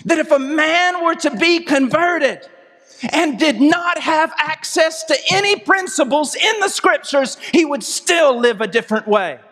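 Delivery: high, emphatic sermon delivery; forceful preaching. Real, not acted. Preacher urging a congregation on conversion, forceful. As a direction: preach it out, pitched high, leaning on key words.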